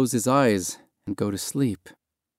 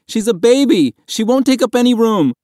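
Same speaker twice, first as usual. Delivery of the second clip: high, loud pleading argument; desperate begging; 13 semitones higher, 6 dB louder than usual. A narrator's reading. Boy begs passengers to take the baby, desperate high plea. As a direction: plead urgently at full voice. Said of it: "Mahmoud begged".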